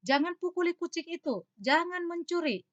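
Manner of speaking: stern prohibition. Shown as a demonstration. Teacher acting out a ban for the class, sternly. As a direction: forbid it firmly, like scolding a child.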